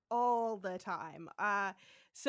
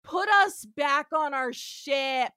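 loud, slow, higher order; mock angry demand; 4 semitones higher, 9 dB louder, slower than usual. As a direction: demand it loudly, word by word. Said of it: "And he's like".

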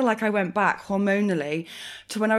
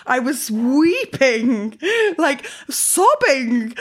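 hugely drawn-out, raised "weeping" and "sobbing"; dramatic emphasis; 5 semitones higher, 4 dB louder, much slower than usual. Real, not acted. Telling friend how badly she cried, dramatic emphasis. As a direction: stretch out "weeping" and "sobbing", pitched high.

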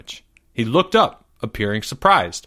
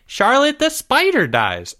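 smug correction; 13 semitones higher, slower than usual. Acted. Jeffrey corrects smugly.

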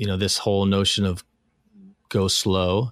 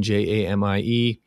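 spelled letter by letter; name each letter clearly; spelling it out. Shown as a demonstration. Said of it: "[name]".

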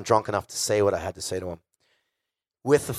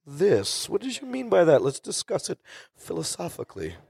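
higher-pitched, mock-earnest questioning voice; inquiring father; 8 semitones higher than usual. Reverend father querying his child's words, high mock-earnest voice. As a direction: imitate a dad opening a scholarly debate. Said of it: "My father will comb through".

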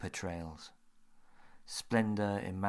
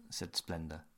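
usual speaking voice

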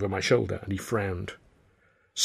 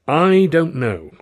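slow, weary admission; grimacing; 6 semitones higher, 6 dB louder, much slower than usual. Acted. Considine admits his doubt about the deal, slow and weary. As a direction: drag it out in doubt. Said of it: "Considine grimaced".

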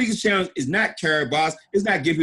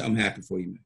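much lower and softer, unimpressed; deadpan; 9 semitones lower, 7 dB quieter than usual. Retold or imitated. Retold reply to a caller, deadpan and unimpressed. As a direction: say it flat like it's nothing.

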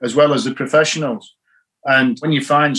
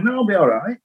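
higher, lightly mimicked boy's reply; a hint of a kid reassuring his coach, higher; lightly voiced young boy; 8 semitones higher than usual. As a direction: lightly imitate a plucky twelve-year-old, pitched up. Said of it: "And he said".

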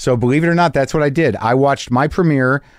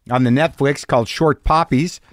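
ordinary delivery

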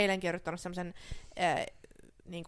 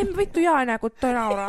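mock offended; 2 semitones higher, 8 dB louder than usual. Real, not acted. Fake offended at laughing friend.

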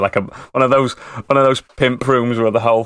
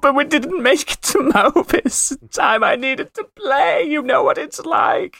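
much higher pleading whine; whiny excuse. Acted. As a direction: plead like a guilty little brother. Character excuses himself.

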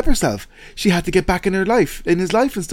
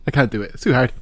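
usual speaking voice

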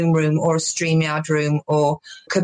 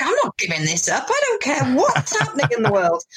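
defiant; 5 semitones higher than usual. Retold or imitated. Acting out her defiant lockdown reaction.

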